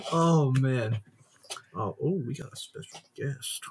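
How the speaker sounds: ordinary talk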